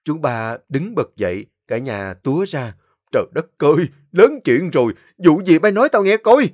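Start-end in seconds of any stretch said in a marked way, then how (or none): 3.1-6.5 loud, high alarmed exclaiming; alarmed uncle. A narrator's reading. Old uncle seeing bloody knife, loud alarm. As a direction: cry out in alarm.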